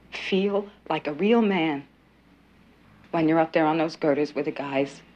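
wife reassures husband he is a real man, tender. Acted. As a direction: gently comfort him.

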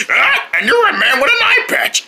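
mocking. Mocking another character.

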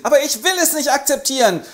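put-on high, loud boy's yell; boy yells at father, high and loud; yelling child. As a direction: shout back at father. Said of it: "brüllt er".

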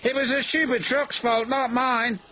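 high-pitched, huffy engine voice; indignant denial. A narrator's reading. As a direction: protest the blame in a high voice. James indignantly blames the trucks.

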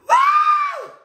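a high, loud whoop well above the voice; excited woo. Real, not acted. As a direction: let out a sudden shout of excitement. Excited outburst mid-story.

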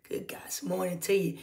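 soft private whisper; hushed good morning; whispered greeting. Real, not acted. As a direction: whisper it as if to one friend. Whispered greeting aside to viewers.

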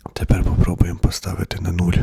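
breathy whisper aside while fiddling. Real, not acted. Testing the mic, he whispers the next step. Mic test, switching gain to zero.

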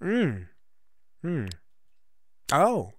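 ordinary talk

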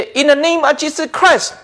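lightly imitated healing prayer, pitched high; pretend faith healer. Preacher mimicking a healer praying, mock proclamation. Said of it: "lấy pretend".

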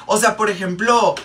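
normal talking voice

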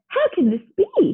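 high, dismayed question; mild outraged shout. A narrator's reading. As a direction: cry out in mild protest. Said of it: "he shouted".

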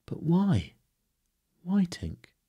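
high, baffled cry of "why"; baffled questioning. A narrator's reading. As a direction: question them in bewilderment, pitched right up. Peter baffled, questioning Tink.

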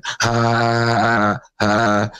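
high sarcastic fake laugh; mock laughter. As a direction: laugh back at them scornfully. Mocking the trolls who laugh.

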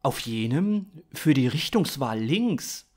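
usual speaking voice

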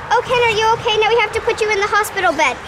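ordinary talk

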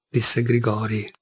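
usual speaking voice

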